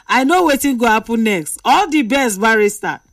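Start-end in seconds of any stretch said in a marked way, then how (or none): none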